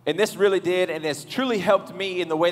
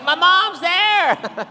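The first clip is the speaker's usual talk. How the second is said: excited squeal; 11 semitones higher, 5 dB louder, much slower than usual. Retold or imitated.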